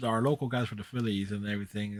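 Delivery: ordinary talk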